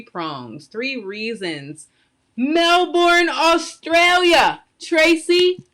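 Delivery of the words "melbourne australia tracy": high, loud, stretched call; excited shout-out. Real, not acted. Host greeting a viewer tuning in from Australia, excited shout-out. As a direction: call out across the world with delight.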